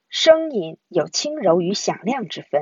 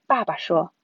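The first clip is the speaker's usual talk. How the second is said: usual speaking voice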